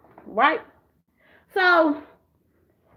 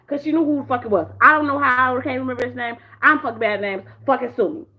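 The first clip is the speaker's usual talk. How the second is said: ordinary delivery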